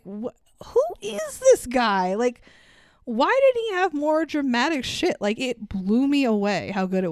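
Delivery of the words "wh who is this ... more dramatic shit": amazed. Retold or imitated. Host retells being blown away by Pryor, amazed and high. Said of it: "I was like".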